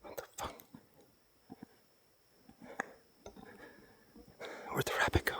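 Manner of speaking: scared breathless whisper. Real, not acted. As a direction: whisper it, barely audible. Frightened in dark woods.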